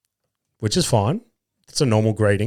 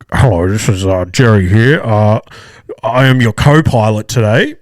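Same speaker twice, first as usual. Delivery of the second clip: mock cockpit announcement, loud and muffled; pilot over loudspeaker; 2 semitones lower, 5 dB louder than usual. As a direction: imitate a hesitant co-pilot on the intercom. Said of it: "the pilot comes over the loudspeaker".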